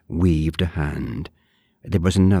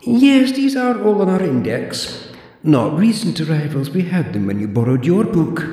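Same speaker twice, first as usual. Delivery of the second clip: put-on high, prim old librarian voice; haughty zombie librarian, clipped and proper; librarian Deirdre; 4 dB louder, slower than usual. Deirdre lectures the borrower in a high prim voice.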